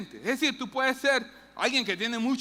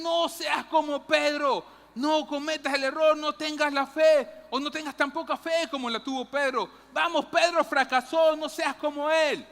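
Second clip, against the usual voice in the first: mock stern warning; 4 semitones higher, 3 dB louder than usual. Common preachers telling the church not to be like Peter, loud stern warning. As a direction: hammer the warning like a scolding preacher.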